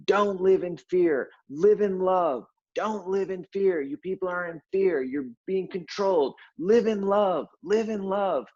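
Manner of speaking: high, slow, sermonizing voice of the angry poster; mock preachy poster. Angry poster lecturing people online, high mock sermon. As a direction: preach love while sounding angry. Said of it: "I'm giving the emphasis to it".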